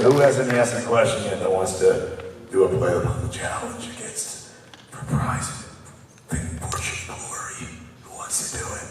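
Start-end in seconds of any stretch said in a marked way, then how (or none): 3.3-8.8 voiceless near-whisper, very soft; no voice left. Real, not acted. Host pitching a challenge to the crowd with no voice. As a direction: let the words come out as air, barely audible. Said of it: "Because I have no voice".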